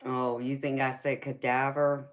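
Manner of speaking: ordinary talk, nothing marked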